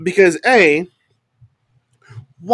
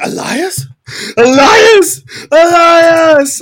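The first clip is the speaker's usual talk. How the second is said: high question building to loud shouts; shocked cries; 12 semitones higher, 7 dB louder, much slower than usual. Retold or imitated. Imitating Jeff shocked to see him, high cries growing louder. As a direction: start in high disbelief, then yell the name. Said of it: "he's shocked to see him".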